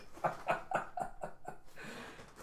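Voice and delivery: long breathy laugh; amused laugh trailing away; laughter. Real, not acted. Reader amused at Granny's drug business, long laugh.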